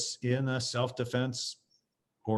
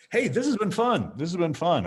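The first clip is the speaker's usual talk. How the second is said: cheery sign-off; 8 semitones higher, 6 dB louder than usual. Real, not acted. Wrapping up the interview.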